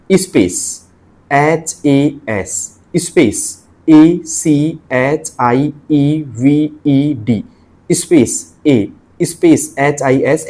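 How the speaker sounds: "स्पेस एच ए एस" spelled out letter by letter, very slowly; spelling out letters. Shown as a demonstration. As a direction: read each letter and every space aloud, slowly. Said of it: "ऐसे पढ़ना चाहिए आपको". (हर अक्षर और स्पेस धीरे-धीरे अलग बोलें)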